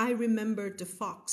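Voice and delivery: ordinary talk, nothing marked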